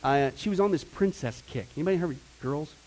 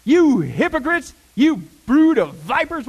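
slow, high, loud denunciation; drawn-out shout at the hypocrites; loud rebuke; 6 semitones higher, 10 dB louder, slower than usual. Retold or imitated. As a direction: thunder it as an accusing preacher. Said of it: "He got loud".

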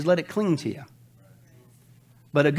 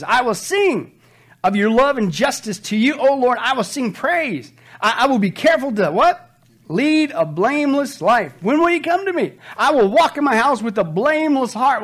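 preaching scripture reading; 5 semitones higher, 4 dB louder than usual. Acted. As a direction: proclaim each line to the congregation. Reading psalm with congregation call.

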